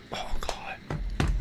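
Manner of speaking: barely audible whispered aside; real under-the-breath dismay; exasperated whisper. Real, not acted. Exasperated at own verbal slip.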